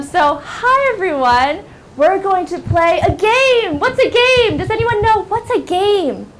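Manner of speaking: put-on high, loud teacher talking to young kids; upbeat classroom teacher. Shown as a demonstration. Teacher opening a game with the class, high and upbeat. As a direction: sing out to a class, high and bright.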